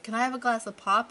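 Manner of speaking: daughter's eager request, high and loud; mock kid asking. Daughter asking grandparents for pop, eager and bright. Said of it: "My daughter's like".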